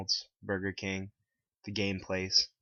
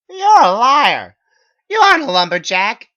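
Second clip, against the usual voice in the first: furious accusing shout; 21 dB louder than usual. A narrator's reading. Narrator confronts Megan at the group, much higher and louder. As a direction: yell it in her face.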